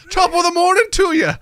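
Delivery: loud, high hammed-up Scottish accent; attempted Scottish accent. Host greeting on request, badly faked Scottish accent. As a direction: take a playful stab at a Scottish accent.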